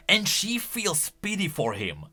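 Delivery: usual speaking voice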